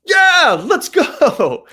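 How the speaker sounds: high, loud hype yell; explosive high-energy cheer; hyped-up shout. Shown as a demonstration. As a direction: shout it like firing up a crowd. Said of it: "if I were to be like".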